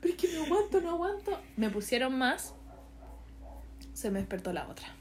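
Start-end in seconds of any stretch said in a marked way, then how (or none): 0.0-1.4 high-pitched mock pleading; squeaky, put-on desperate whine; retold desperation. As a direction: re-enact pleading through labour pain. Acts herself in unbearable labour pain.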